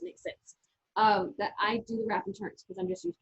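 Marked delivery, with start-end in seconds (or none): 0.0-0.6 under her breath, voiceless; a quick whispered aside. Real, not acted. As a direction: breathe it like a side comment. Aside while explaining habits.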